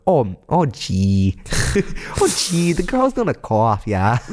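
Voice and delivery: put-on drawn-out Fargo "oh, gee" accent; North Dakota accent. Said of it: "I knew you could do that accent".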